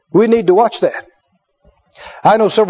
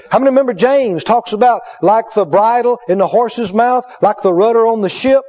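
ordinary talk

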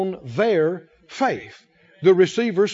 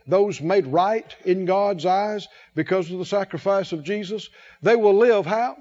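ordinary delivery

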